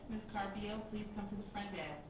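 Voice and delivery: usual speaking voice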